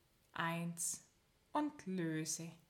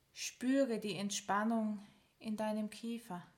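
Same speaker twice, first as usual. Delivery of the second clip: normal talking voice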